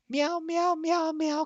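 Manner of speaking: high, playful cat meows; silly kitty noises for the viewers; mock meowing. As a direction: meow like a cartoon cat. Meowing to introduce cat cam.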